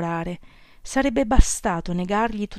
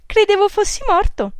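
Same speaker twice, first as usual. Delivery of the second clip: bright, much higher and louder joking tease; cheerful; 13 semitones higher, 7 dB louder than usual. A narrator's reading. Girl teasing Jocelyn on the phone, much higher and louder, merry. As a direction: toss it off gaily as if it's a joke. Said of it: "disse allegramente".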